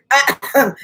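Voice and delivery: quick dry cough. Real, not acted. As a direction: clear the itchy throat with a cough. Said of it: "I'm still drinking my water".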